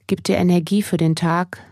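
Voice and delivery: ordinary delivery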